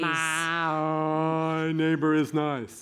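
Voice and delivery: very slow, one held tone; long intoned drill; sustained voice exercise. Shown as a demonstration. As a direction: stretch each word out fully. Coach demonstrates voice drill.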